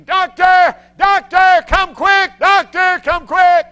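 high, loud panicked yelling; nurse screaming. Retold or imitated. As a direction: scream for the doctor in alarm. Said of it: "she runs out screaming".

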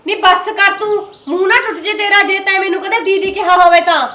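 heated shout at sister-in-law; angry threat. Acted. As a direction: cut her off with a threat.

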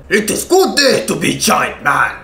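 lightly voiced Russian strongman; hint of a Russian giant; mock Russian accent. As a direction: put on a cartoon Russian accent. Quotes Heavy's voice line.

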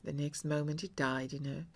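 ordinary delivery

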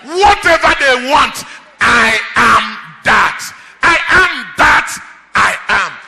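loud, rising preacher's cry to the congregation; preaching at full force. Acted. Preacher proclaiming God's name to the church, at full force. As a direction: preach it out, driving every line home.

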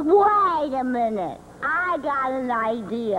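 low, slow voice with an idea; scheming third string. Acted. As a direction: draw it out low, as if plotting. Third string hatches a plan.

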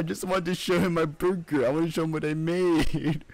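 high-pitched tearful kid imitation; mock crying. Kid explaining to dad about the burger, mock crying. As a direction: whine it through pretend sobs. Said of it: "I was crying".

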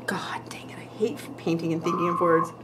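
whispered under the breath while painting; frustrated whisper. Real, not acted. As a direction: grumble it to yourself in a whisper.

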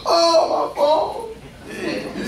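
high, drawn-out laughing gasp; mock gasp. Preacher mocks someone who drops their phone, gasping. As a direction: overplay horror at a cracked phone. Mocking a broken phone owner.

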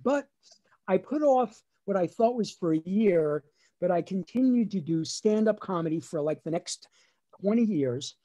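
ordinary talk, nothing marked

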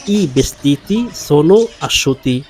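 very slow, each word laid out clearly; slow teacher demo; slow model reading. Shown as a demonstration. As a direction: spell the sentence out slowly for learners. Teacher models Italian sentence.

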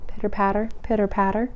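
soft pitter-patter; gentle raindrops. Acted. Voicing gentle rain falling softly.

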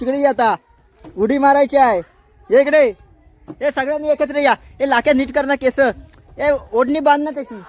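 loud, high rounding-up call; shouting instructions. Real, not acted. Rounding up children before performance.

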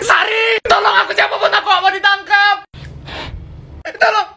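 high-pitched playful plea to a friend; pretend cry for help. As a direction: call for rescue in a chase game. Playing thief calls for help. (teriak minta tolong main-main)

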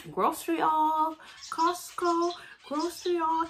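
high, playful reveal voice; high sing-song vlog announcement; excited announcement. Real, not acted. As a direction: announce it in a squeaky high pitch. Announces the next video segment.

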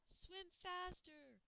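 slow, high, drawn-out call; a strange unseen voice calling; mysterious voice. A narrator's reading. An unseen voice calls to the children in the river, slow and high. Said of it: "a voice called out".

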